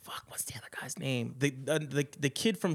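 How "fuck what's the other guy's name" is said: unvoiced whisper, forgetting a name; whispered to self. Real, not acted. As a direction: mutter it under your breath. Muttering to himself, forgotten name.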